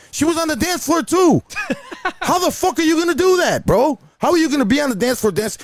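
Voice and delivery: very high-pitched comic outrage; incredulous rant. Real, not acted. DJ rants about complainer who was dancing, incredulous. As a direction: let your voice leap up in disbelief.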